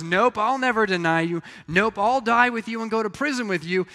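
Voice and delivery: insistent Peter. Retold or imitated. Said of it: "and Peter goes".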